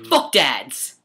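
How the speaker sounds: loud cheeky yell; loud joking shout. Pretend. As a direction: blurt it out loud. Shouted joke.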